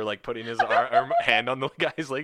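ordinary talk, nothing marked

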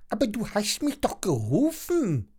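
high, put-on dragon voice with reproach; reproachful. A narrator's reading. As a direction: protest as the wronged little dragon. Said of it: "sagt Hector vorwurfsvoll". (hohe Drachenstimme, vorwurfsvoll)

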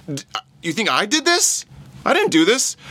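high, loud protesting disbelief; incredulous. Acted. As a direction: deny it as if the idea were absurd. Peter voiced disclaiming the miracle.

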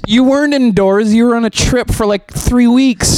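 incredulous. Real, not acted. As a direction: stretch out the three weeks in disbelief. Disbelieving rebuttal, high pitch.

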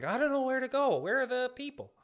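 a lightly voiced lost, curious Canada. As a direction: play a confused person looking around. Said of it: "like, curious".

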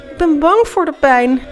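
high, loud acted blubbering; tearful wail. A narrator's reading. Felix confesses fear to Julius, wailing tearfully. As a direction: wail it as if crying. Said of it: "barstte in snikken uit".

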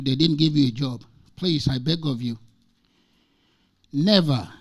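ordinary talk, nothing marked

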